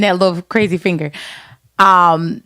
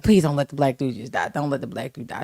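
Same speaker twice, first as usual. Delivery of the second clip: quiet plea; 7 semitones lower, 10 dB quieter than usual. Retold or imitated. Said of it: "you're like".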